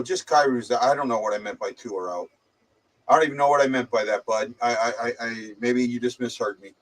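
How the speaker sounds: usual speaking voice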